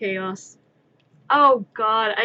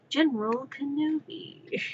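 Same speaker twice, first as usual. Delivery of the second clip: General Grievous; 4 semitones higher, 5 dB quieter, slower than usual. Pretend. Grievous meme reply.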